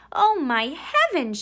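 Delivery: high, loud, slow gasp of alarm; shocked mother. Acted. Mother finding her striped daughter, shocked high cry. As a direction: cry it out like a startled mom. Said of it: "she cried".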